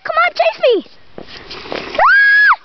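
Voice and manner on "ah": high, loud play scream of fright; mock scream. Parent fleeing Greg the pretend monster, loud high scream. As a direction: shriek as if the monster's got you.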